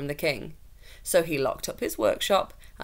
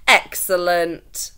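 slow, loud, savoured word; relished approval; 10 dB louder, much slower than usual. A narrator's reading. Lord pleased with the carpenter, slow and loud. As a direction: roll it out with satisfaction.

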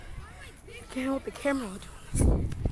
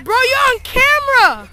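loud, high and slow warning; scolding call; 10 semitones higher, 11 dB louder than usual. Real, not acted. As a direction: warn your friend he's being filmed. Friend swears on camera.

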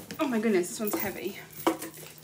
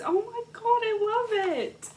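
delighted; 9 semitones higher than usual. Real, not acted. Delight at Besties gift.

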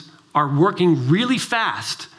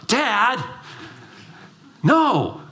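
imitated offended teenage protest, pitched up; indignant teen; 6 semitones higher than usual. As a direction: protest like an affronted son. Teen son denies drug question.

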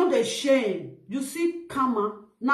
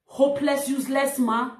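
usual speaking voice

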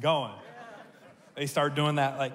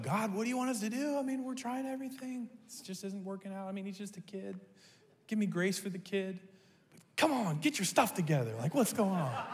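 exasperated plea to God, pitched high; venting at heaven in disbelief; dad complaining to God in the restroom, high and exasperated; frustrated prayer; 5 semitones higher than usual. Retold or imitated. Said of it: "I was pretty frustrated".

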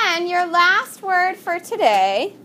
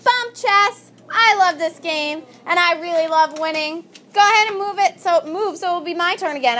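higher-pitched, pushy chess player's boast; yelling out; 4 semitones higher than usual. Acted. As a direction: crow over the board in a mock yell. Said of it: "yells out".

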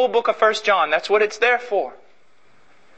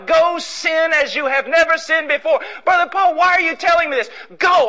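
heated retold exchange; 8 semitones higher than usual. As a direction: push him out the door.

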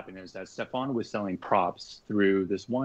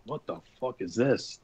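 put-on British accent; 3 semitones higher than usual. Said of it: "They're, like, two big British guys".

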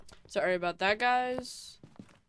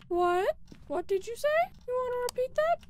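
very high, louder panicked questions; alarmed; 5 dB louder than usual. Real, not acted. Player hears something from the ghost: high, panicked, louder questions. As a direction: shriek at an unseen voice in fear.

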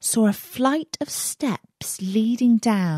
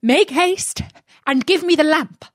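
high, louder urgent cry; cried out; 7 semitones higher, 5 dB louder than usual. Acted. Magician at the cave mouth demands the lamp, high urgent cry. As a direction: shout up the stairs, impatient and greedy. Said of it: "The magician cried out".

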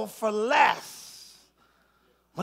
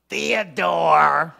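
"Theodore" slowly stretched out, low-pitched; 7 semitones lower, 5 dB louder than usual. Shown as a demonstration. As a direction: draw out each part of "Theodore". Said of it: "when you say it slow".

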